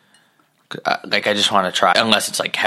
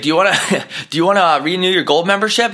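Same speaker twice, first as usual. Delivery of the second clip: pushy clerk; 9 semitones higher, faster than usual. Retold or imitated. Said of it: "he's like".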